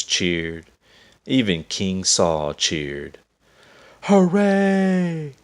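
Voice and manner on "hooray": very high, loud cheer; cheering crowd. A narrator's reading. Soldiers cheering David's victory, high loud hooray. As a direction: shout it as a joyful crowd. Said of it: "they all cried".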